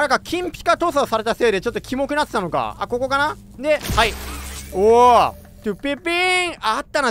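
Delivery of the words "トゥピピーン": Japanese "トゥピピーン" as a quick rising jingle; mouth sound effect. Retold or imitated. Recalling a game chime, mouth jingle. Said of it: "こんな効果音".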